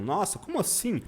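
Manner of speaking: high-pitched feigned disbelief; mock shock. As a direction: pretend to be scandalised by a tiny slip. Mock shock at a mispronounced word.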